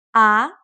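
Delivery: "Ah" with a clean upward glide; second tone. Shown as a demonstration. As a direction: lift the pitch upward through "Ah". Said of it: "starts in the middle".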